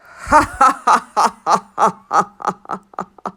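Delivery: big loud belly laugh; roaring lion laugh. Acted. The happy lion roars with laughter, loud and long.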